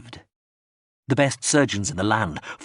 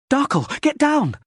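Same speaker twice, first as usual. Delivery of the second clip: much higher, louder panicked scream; 5 dB louder than usual. A narrator's reading. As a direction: yell the warning across the gunfire. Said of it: "Liv Waiter screamed".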